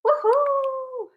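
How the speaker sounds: high cheer. Real, not acted. Cheering about birthday plan.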